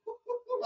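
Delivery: high breathy chuckle; quick laugh. Real, not acted.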